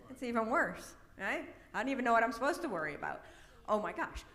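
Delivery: playfully flustered mock panic; mock worrying. Acts out spiralling worry of listener.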